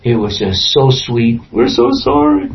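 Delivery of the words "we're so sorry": high, pleading apology of weeping brothers. Acted. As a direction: beg forgiveness in a high, tearful voice.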